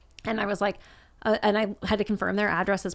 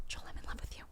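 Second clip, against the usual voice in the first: breathy whispered love confession; 17 dB quieter, faster than usual. Retold or imitated. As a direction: whisper it like a shy teen confessing. Confessing love across picnic table.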